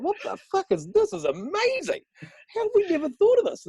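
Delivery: amazed coworkers. Retold or imitated. Coworkers tasting cheese rolls for the first time, high mock amazement. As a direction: squeal it out like stunned coworkers. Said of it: "They're like".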